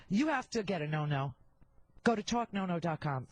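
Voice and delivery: ordinary delivery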